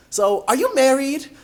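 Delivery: high, loud, drawn-out question; nosy child. Retold or imitated. Third grader questioning a visiting supervisor, pointedly nosy. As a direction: imitate a third grader asking point-blank.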